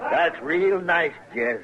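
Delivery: high, slow, sneering praise; slow mocking admiration. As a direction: savour it, mocking the barkeep. Gunman admires the shot-up glasses.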